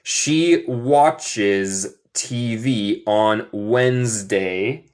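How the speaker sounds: very slow, words stretched and spaced; slow model reading. Shown as a demonstration. Teacher models a sentence for students, slow model reading. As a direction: read it slowly for learners to follow.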